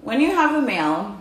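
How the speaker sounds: can't get through it for laughing; giggles. Real, not acted. Host trying to summarize but giggling helplessly. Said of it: "we have a case of the giggles".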